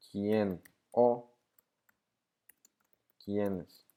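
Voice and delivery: palabra por palabra, con pausas largas; dictado pausado. Shown as a demonstration. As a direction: dictate each word separately while writing it. Dicta las palabras mientras las escribe. (dictado lento, palabra por palabra)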